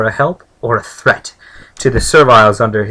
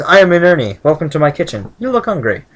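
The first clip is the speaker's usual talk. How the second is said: a bright, higher, welcoming cook; cheerful cook; 6 semitones higher, faster than usual. Acted. Cook Inurni welcomes the hungry visitor, bright and friendly. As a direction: greet the guest like a busy kind cook.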